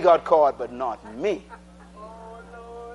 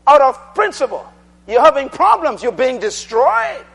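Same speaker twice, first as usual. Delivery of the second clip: louder, higher preaching voice; full-force preaching; 3 semitones higher, 4 dB louder than usual. Acted. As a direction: build it up to the congregation.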